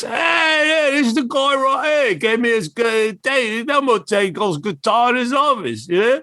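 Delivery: high excited shout. Retold or imitated. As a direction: Bon pointing out the kid, high excited yell. Bon shouting excitedly in hallway.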